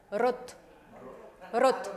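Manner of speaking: rot with a short, light R; Russian rot. Shown as a demonstration. Teacher showing the Russian rot to students. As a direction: say rot with a quick, barely tapped R. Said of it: "la R no es tan pronunciada".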